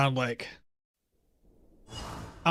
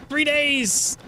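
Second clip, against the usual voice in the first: mock exclaim; 9 semitones higher than usual. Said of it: "I don't know why Arna sounds like that".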